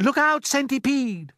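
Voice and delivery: high, loud warning shout; boy crying out in alarm; urgent cry. A narrator's reading. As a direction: shout to warn a friend in danger. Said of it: "cried James".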